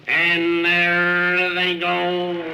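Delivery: brisk racetrack announcer play-by-play; race call. Acted. Announcer calls the race to the crowd, live play-by-play.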